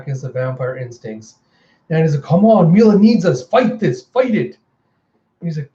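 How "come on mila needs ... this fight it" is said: high, raised-voice plea; desperate urging. Acted. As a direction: urge them on with acted desperation. Urging Effie to resist instincts.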